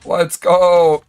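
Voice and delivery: high, loud celebratory yell; hyped cheer. Real, not acted. Streamer celebrates a bonus of three hundred fifty with a loud, high cheer. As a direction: shout it to celebrate.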